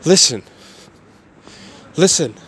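ordinary delivery

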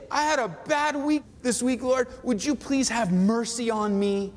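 high-pitched, insistent mock begging; imitating an earnest plea to God; imitated pleading prayer. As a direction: plead for mercy, pitched well up. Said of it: "You say".

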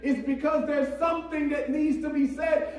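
high, urgent pulpit voice; impassioned preaching. Real, not acted. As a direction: preach it so everyone must hear. Preaches at full force.

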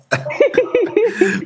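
squealing laugh; high laugh. Real, not acted. Big laugh.

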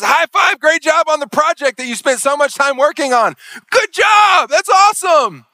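excited boss praise. Retold or imitated. Boss bursting with praise at his work, shouting. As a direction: cheer him on like a thrilled boss.

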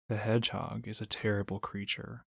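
ordinary delivery

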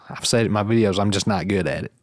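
usual speaking voice

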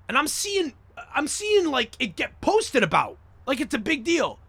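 high-pitched, scornful disbelief; incredulous rant. Real, not acted. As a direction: let the voice climb high as if baffled by the fuss. Incredulous rant about the posts.

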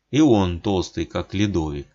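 plain reading voice

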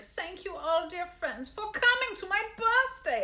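high, odd character voice; put-on high-pitched thank-you speech; Pee Wee Herman. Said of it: "it's Pee Wee Herman? He's like".

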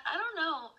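put-on high-pitched girly lilt. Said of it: "like, girly and shit".

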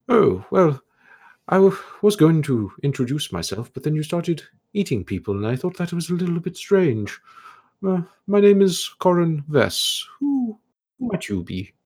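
put-on high, airy voice of a curious old elf scholar; scholarly elf ghost. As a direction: play the puzzled, polite ghost scholar, pitched high.

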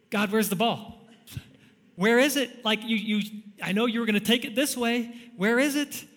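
high, frantic mock plea to God; panicked search. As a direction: call out lost and panicking. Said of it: "we go".

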